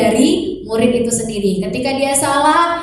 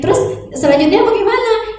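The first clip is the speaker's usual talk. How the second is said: much higher questioning mum; curious mother; 10 semitones higher than usual. Retold or imitated. Mother asks son what he will do next, high.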